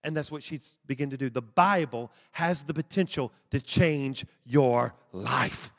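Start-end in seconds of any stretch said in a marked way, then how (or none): none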